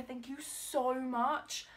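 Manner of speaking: slow, stretched thanks with a rise on so; slow earnest thanks to a friend; drawn-out heartfelt thanks. Real, not acted. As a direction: linger on every word, grateful.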